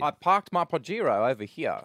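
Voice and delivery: a put-on South African accent; mock Kevin Peterson accent. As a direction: send up the cricketer's accent. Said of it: "as bad as your Peterson".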